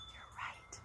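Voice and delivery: hushed whisper; storyteller whispers to the children. A narrator's reading. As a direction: lean in and whisper it. Answering children in a hush.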